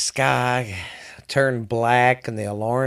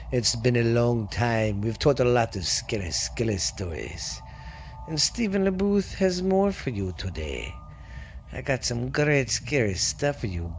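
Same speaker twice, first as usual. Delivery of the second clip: spooky host voice; 2 semitones lower, 5 dB quieter than usual. Acted. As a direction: welcome listeners like a campfire ghost storyteller. Spooky podcast host intro.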